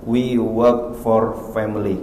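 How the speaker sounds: drawn-out English reply; slow quoted answer. Retold or imitated. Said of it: "Apa kata si penjawab ketiga?". (pelan dan datar)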